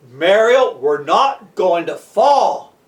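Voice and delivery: slow, low, steady voice; firm reassurance. Acted. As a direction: settle a panicking friend, unhurried. Rescuer calmly reassures terrified boy.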